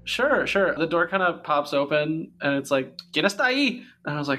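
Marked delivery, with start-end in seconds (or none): 3.1-3.8 high-pitched Spanish-speaking abuela; grandma voice. Acted. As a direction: call out like a curious grandmother. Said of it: "me voicing her?".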